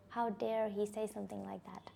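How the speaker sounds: hushed, breathy indignant whisper; whispered outrage. Retold or imitated. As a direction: hiss it under your breath, still furious.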